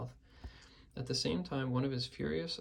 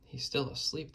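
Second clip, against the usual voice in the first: ordinary delivery, nothing marked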